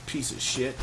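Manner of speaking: ordinary talk, nothing marked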